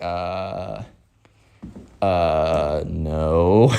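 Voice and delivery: low, hesitant, drawn-out uhs; awkward refusal. Acted. Caroline has to turn down the kid, awkward refusal.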